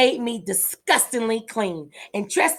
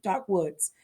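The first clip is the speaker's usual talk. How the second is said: usual speaking voice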